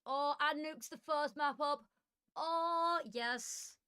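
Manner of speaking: delighted, bright burst; excited. Real, not acted. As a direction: cheer that your favourite map came up. Thrilled Nuke map is first.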